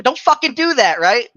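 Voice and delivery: loud, high scolding burst; retold yell. Backseat driver husband re-enacting correcting his wife, sharp loud yell. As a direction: snap into a high, loud telling-off. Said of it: "I'm gonna yell at her the same way".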